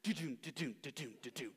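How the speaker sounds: playful drum sounds; vocal drum fill. Retold or imitated. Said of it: "drum solo in In the Air Tonight".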